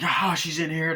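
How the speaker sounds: mock shout to the others; calling out. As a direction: raise the alarm like a pursuer. Acts out pursuer calling others.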